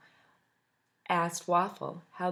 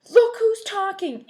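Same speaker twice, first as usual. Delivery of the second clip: much higher, louder, slow snap; angry retort; 12 semitones higher, 12 dB louder than usual. Acted. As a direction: shout it back. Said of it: "answered Egg".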